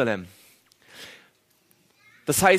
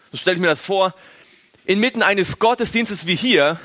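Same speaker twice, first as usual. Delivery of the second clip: usual speaking voice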